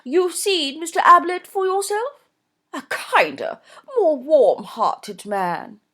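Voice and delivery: high, loud gushing society mother; gushing Mrs Norbury. A narrator's reading. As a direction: voice her high and effusive, a fussy mother. Narrator voices Mrs Norbury.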